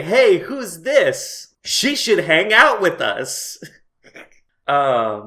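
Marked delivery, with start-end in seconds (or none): 0.0-3.6 slightly raised, slow character quote; lightly voiced mer friends. Host mimics the two mer friends' only line, lightly voiced. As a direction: draw out the friends' line, a little louder.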